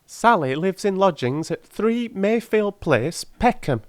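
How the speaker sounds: put-on high, pleading old crone voice; a poor old charwoman begging humbly; old woman. Old woman begs the gentlemen for the ring, high and humble.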